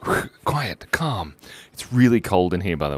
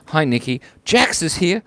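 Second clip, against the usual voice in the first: ordinary delivery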